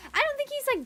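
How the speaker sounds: ordinary talk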